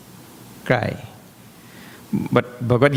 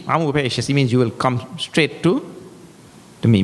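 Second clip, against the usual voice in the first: normal talking voice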